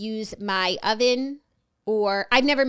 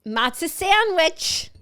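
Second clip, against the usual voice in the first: drawn-out, raised-pitch menu call; playful announcement; 7 semitones higher, 4 dB louder, much slower than usual. Pretend. Co-host announces the lunch item, playful drawn-out call. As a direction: announce it slow and high, playfully.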